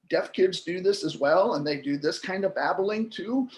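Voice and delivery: usual speaking voice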